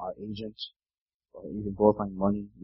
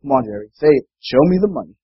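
pushy demand; 2 semitones higher, 8 dB louder than usual. Retold or imitated. Rod pushing Jerry to say it.

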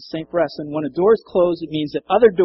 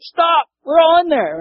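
high, loud urgent shout; yelling out; 13 semitones higher, 9 dB louder than usual. Retold or imitated. Paul yelling to the jailer about to kill himself, urgent shout. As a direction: shout to stop him in time. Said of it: "And he's like".

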